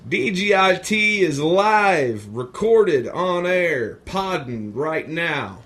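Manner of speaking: slow, drawn-out show announcer. Acted. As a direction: announce it like a radio host.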